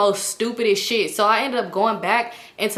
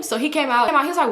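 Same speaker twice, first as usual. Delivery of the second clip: normal talking voice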